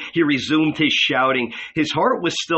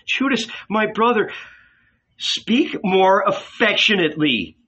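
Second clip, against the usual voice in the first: plain reading voice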